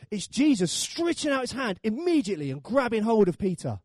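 ordinary talk, nothing marked

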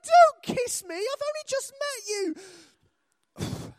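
high, loud comic alarm; hammed-up horrified refusal; panicked protest. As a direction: squawk it, then stretch it out high. Retold protest at stranger's kiss.

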